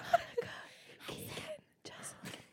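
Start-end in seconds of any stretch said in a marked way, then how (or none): 1.1-2.4 whispered names; whisper, holding in laughter. Acted. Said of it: "whisper them all without laughing".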